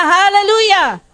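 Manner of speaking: high ringing call of praise; shouted hallelujah. Shown as a demonstration. Preacher calling the church to shout it. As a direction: lead the crowd, call it out high. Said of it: "Isa pa".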